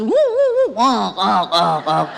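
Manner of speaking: car engine whoops, then chokes out; imitated straining car engine. Wife mimicking the old car stalling uphill, comic engine noises.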